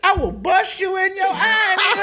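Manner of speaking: high-pitched incredulous shouting, half laughing; mock outrage. Husband acting out his reaction to his wife, mock outrage. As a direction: erupt high in comic jealous outrage.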